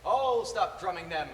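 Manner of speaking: mock-villain delivery, slow and drawn out; quoting ex-girlfriend's routine. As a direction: imitate the cartoon threat, swelling on FOREVER. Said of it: "And she'd go".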